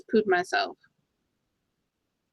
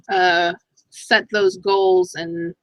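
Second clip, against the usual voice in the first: normal talking voice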